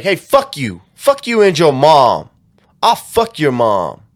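loud, drawn-out retold cursing; mock abusive rant. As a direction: act out a reckless troll's insults. Retold abusive messages from reckless scammer.

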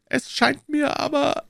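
very high, slow, hesitant voice; timid old shopkeeper. A narrator's reading. Shopkeeper doubting D'Artagnan's plan, timid protest. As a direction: object feebly and high.

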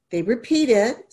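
ordinary talk

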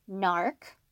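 ordinary delivery

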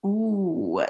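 ordinary talk

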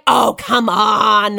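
slow, drawn-out mock American scoffing; American accent. American friend refusing to believe her, mock American scoff. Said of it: "my accent's terrible".

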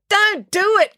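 high, loud plea; crying out. A narrator's reading. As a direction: cry it out in alarm. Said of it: "cried Finn".

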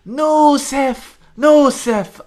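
high, loud pretend begging; mock crying plea. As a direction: whine it like crying for his life. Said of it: "crying for his life and he's going".

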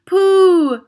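very high, loud pooh; disgusted cry. Acted. Witch audience crying out in disgust, high. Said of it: "cried the audience".

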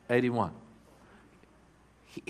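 usual speaking voice

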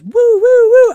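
loud, high siren wail; imitated police siren. Storyteller imitating the police arriving, loud siren wail. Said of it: "all I hear is".

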